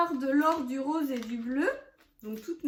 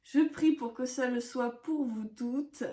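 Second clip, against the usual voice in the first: ordinary talk, nothing marked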